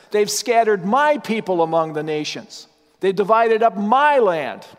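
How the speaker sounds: every "my" hit and falling; stress on MY. Shown as a demonstration. Preacher pointing out God's claim, stressing each "my". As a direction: lean on each "my" in "my people", "my land". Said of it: "Look again at the emphasis".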